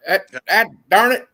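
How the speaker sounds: mock Kentucky cussing; hammed-up Kentucky accent. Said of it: "From Kentucky".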